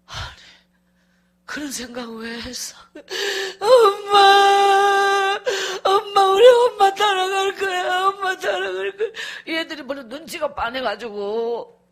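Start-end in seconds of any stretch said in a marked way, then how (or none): 3.6-9.1 put-on high pleading child's wail, loud and drawn out; child crying. Son begs his mother not to leave him, wailing. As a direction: beg through sobs, stretching the words. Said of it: "애가 계속 울어".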